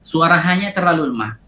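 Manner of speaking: ordinary talk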